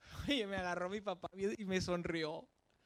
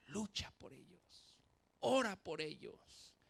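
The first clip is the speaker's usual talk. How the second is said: earnest plea; slower than usual. Real, not acted. Preacher urging parents, slow and earnest. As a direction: let each command land gently.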